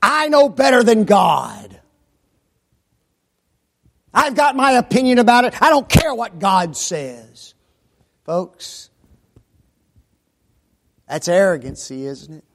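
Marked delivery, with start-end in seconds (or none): 0.0-7.5 proud defiant voice. Retold or imitated. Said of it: "Satan still uses that avenue".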